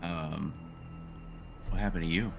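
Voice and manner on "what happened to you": ordinary delivery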